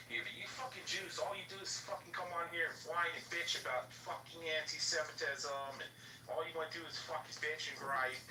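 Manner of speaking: worked-up, high-pitched griping; ranting. Retold or imitated. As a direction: imitate an angry man's rant. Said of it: "went on a whole fucking rant".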